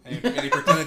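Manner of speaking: loud, laughing through the words. Real, not acted. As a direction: burst out laughing mid-sentence. Laughing while telling a story.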